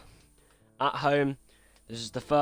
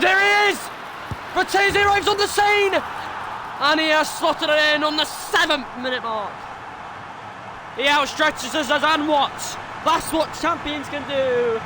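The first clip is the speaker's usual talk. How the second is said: much higher, louder ecstatic goal call; goal shout; 13 semitones higher, 5 dB louder than usual. Acted. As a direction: scream it like the winner just went in.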